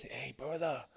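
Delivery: very soft, low mimicked voice; low and quiet, quoting him; hushed retold greeting. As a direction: imitate a wounded friend's subdued call. Quoting friend who was shot.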